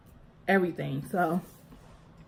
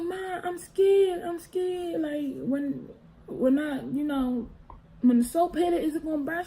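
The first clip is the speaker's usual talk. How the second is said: put-on scared child; 7 semitones higher than usual. Girl telling mom she fears the soap will burn, high and scared. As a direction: whimper it like a scared kid. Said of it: "And I was like".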